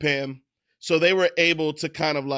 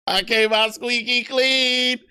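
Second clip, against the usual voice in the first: mock innocent; 6 semitones higher, slower than usual. Same line read again.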